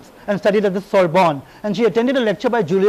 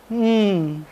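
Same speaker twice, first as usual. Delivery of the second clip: brief, noncommittal British hum; understated British reserve. Retold or imitated. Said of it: "all she said as a British woman".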